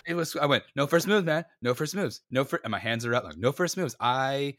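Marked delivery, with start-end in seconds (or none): 0.7-2.6 high-pitched panicky repetition; mock panic on a loop; panicked error mode. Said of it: "went into an error mode". 3.4-4.0 higher, retold panicky repeat; stuck-record mock panic; panicked error mode. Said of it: "went into an error mode".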